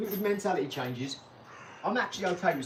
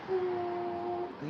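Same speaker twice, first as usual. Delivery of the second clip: soaring pitch; 12 semitones higher, 4 dB quieter than usual. Pretend. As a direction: voice climbs much higher and slows right down. Showing the graph climbing.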